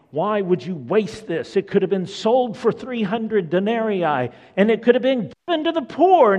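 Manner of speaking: rising outrage over the waste; displeased protest. Acted. Disciples scolding the woman, building to a loud protest. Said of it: "they're greatly displeased".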